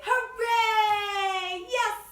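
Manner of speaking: high, loud drawn-out cheer; jubilant, high-pitched celebration. Real, not acted. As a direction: shout a long hooray. Cheer after the song.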